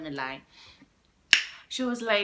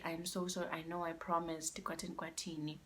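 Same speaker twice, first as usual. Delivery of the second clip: hushed and sorry, as she said it; softly pleading girlfriend; soft apology; 13 dB quieter than usual. Retold or imitated. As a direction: voice the girlfriend's apology much softer. Girlfriend's apology voiced again.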